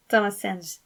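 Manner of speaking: ordinary delivery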